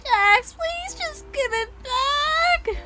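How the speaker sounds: very high, slow pleading whine; high-pitched wheedling plea; whiny pleading. Acted. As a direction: beg in a high, drawn-out crybaby voice. Acting Gangle pleading for her mask.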